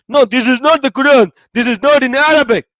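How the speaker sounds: high, loud retold objection, hitting "not"; mock indignant protest. Retelling how the Muslims protested, loud and high-pitched. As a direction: voice the crowd insisting "not" with mock outrage.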